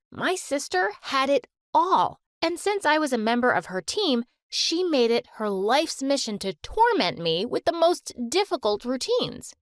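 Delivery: usual speaking voice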